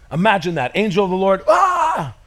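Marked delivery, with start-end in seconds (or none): 1.5-2.1 high shout of fright; startled cry. Retold or imitated. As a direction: yelp like a shepherd seeing an angel. Shepherds startled by angel.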